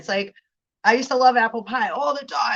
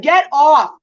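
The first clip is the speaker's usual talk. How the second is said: much louder get off; mock snap; 5 semitones higher, 11 dB louder than usual. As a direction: parent finally losing patience. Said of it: "And it's like".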